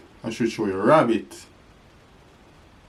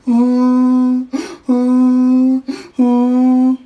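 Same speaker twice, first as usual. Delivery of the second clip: donkey bray; 13 semitones higher, 7 dB louder, much slower than usual. Shown as a demonstration. Donkey sound.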